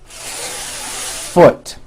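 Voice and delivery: FOOT with the F hissed for a full beat first; long held F. Shown as a demonstration. Teacher showing the fix, long exaggerated F in FOOT. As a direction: stretch the airy F, then pop out FOOT. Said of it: "give the F one beat".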